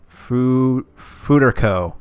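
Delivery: FUTERCO sounded out loudly, first syllable tried and restarted; attempted pronunciation. Real, not acted. Host guessing at a subscriber's name, attempted pronunciation. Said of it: "That's how I'm gonna pronounce that".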